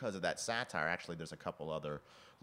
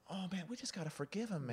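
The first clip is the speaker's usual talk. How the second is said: high, soft mock plea; pleading husband; 11 semitones higher, 4 dB quieter than usual. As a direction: imitate a meek appeal for forgiveness. Imitated husband pleading forgiveness.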